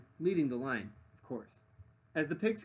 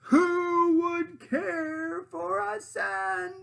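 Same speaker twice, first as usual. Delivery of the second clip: high, very slow, drawn-out loud call; sing-song lure from afar; luring witch voice; 9 dB louder, much slower than usual. Acted. The distant voice calls again.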